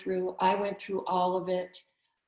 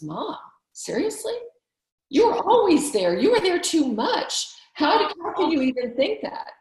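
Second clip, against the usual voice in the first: higher and louder, flatly disbelieving; a grown daughter brushing off her mom's guilt, loud and high; incredulous daughter; 8 semitones higher, 7 dB louder than usual. Retold or imitated. Voicing daughter's incredulous reply.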